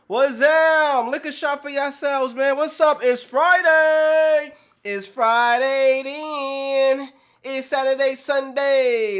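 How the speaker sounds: high, loud, dragging the days out slowly; hype shout. Real, not acted. Streamer calls out a toast to chat, loud drawn-out hype. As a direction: chant it to the crowd like a party host.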